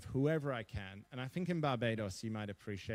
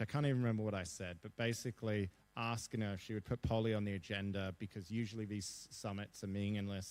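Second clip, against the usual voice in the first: usual speaking voice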